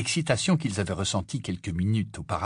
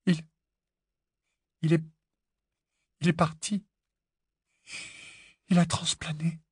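high, halting, tearful voice; on the verge of tears; 6 semitones higher, 4 dB quieter than usual. A narrator's reading. Hermione tells Harry that Ron left, tearful. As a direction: break it up with long pauses, close to crying.

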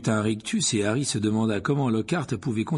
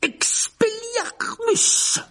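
very high, very slow ringing incantation; cried out spell; 5 dB louder, much slower than usual. A narrator's reading. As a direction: fling the spell across the hall. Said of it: "s'écria Rogue".

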